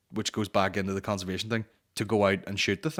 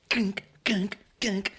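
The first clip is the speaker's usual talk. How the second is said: flatpack thwack noises; 11 semitones higher, much slower than usual. Retold or imitated. Hitting a man with flatpack.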